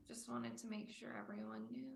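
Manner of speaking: embarrassed unvoiced whisper. Real, not acted. Player quietly admitting the age joke, whispered. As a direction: mutter it under your breath.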